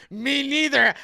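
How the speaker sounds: high, loud retold outburst; shouted punchline. Coach admits he didn't know either, shouted.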